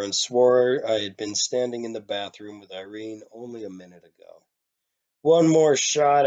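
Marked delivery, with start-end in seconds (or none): 5.2-6.3 put-on slow, loud drunken yell. A narrator's reading. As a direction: bellow it at the bartender, slow and heavy. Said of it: "I yelled at the bartender".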